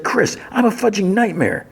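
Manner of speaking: high-pitched retold exasperation; exasperated outburst. She admits her flaws to Chris, acted exasperation. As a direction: blurt it out high, acting her frustration.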